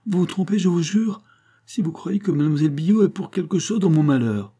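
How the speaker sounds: acted begging protest; pleading. A narrator's reading. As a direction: swear it imploringly. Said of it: "en joignant les mains".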